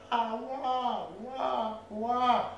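high parrot squawks; imitated parrot screech. Owner imitates his loro screaming behind the door, screeching.

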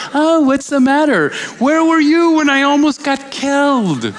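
indignant retort. Retold or imitated. His sarcastic retort to friends.

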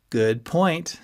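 enjoy the jab as you agree; with relish. A narrator's reading. Friend enjoying another's offence, with relish. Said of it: "Ski said with relish".

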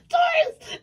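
very high, loud shriek through laughter; worked-up shout. Real, not acted. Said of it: "I got pissed right there for a second".

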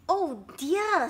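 alarmed for a friend; dismayed. Acted. Snake sees elephant's nose caught, dismayed.

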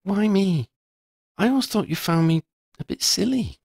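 put-on a Ravenclaw girl asking gently if it's serious; lifted young voice for Shona; Shona, curious schoolgirl. A narrator's reading. Shona's unsure question.

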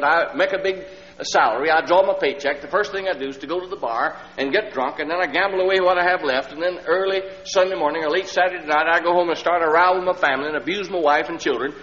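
a broken man begging the preacher for help; retelling the drunkard's plea in a rising high voice; desperate confession. Retold or imitated. Drunk man pours out troubles.

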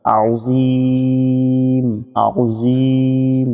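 আযীম very slow, throaty ain at the start; pressed ain. Shown as a demonstration. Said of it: "আইন দিয়ে চাপ দিতে হবে". (আযীম-এ আইন চাপ দিয়ে টেনে)

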